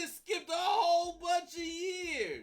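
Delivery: high, slow, laughing yell; shout. Real, not acted. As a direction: yell it out in disbelief. Yelling at friend's skipped years.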